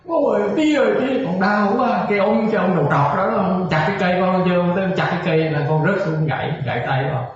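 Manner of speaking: put-on high whiny child voice complaining to dad; crying child. Hurt spirit son cries to his dad, high whine. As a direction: wail like a hurt little boy telling on the monk. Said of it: "nó về nó khóc bù bù loa".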